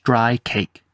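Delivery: ordinary delivery, nothing marked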